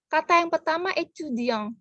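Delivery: ordinary talk, nothing marked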